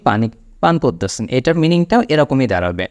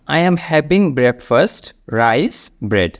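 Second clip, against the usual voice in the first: slow model sentence; much slower than usual. Shown as a demonstration. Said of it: "যেটা হচ্ছে দেখুন".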